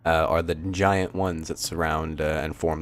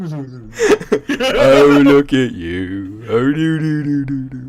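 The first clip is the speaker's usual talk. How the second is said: put-on high, slow cooing baby talk; 6 semitones higher, 6 dB louder, much slower than usual. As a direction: coo at him like a baby. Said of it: "sounds like baby talk".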